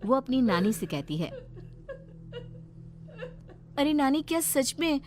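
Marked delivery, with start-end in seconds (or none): 3.8-5.0 put-on girl's voice rising higher into anxious pleading; a frightened girl begging to know; worried young Seema. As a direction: Seema pressing her nani about the screams, anxious and higher. Seema worried about crying.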